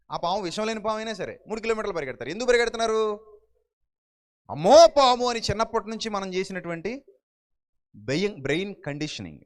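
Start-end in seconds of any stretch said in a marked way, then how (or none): 4.5-5.3 loud startled cry of fear; scared cry. Retold or imitated. Parent shrieking at a snake, loud scared cry.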